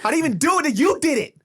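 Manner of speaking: high mock-indignant voice; mock outrage. Uncle jokingly scolding the kid, high and mock-indignant. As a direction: play hurt at a little kid. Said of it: "I'm like".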